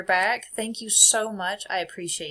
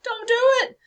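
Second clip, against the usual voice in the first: high loud pleading no; higher, louder mock plea; mock pleading cry; 9 dB louder than usual. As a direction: beg yourself to stop, hammed-up. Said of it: "I'm in there going".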